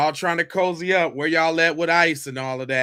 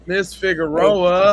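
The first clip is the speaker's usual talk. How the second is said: louder, slow "Miss Figueroa"; calling out; 2 semitones higher, 6 dB louder, slower than usual. Real, not acted. Host calling to the woman in the clip, loud. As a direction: call her name over the clip.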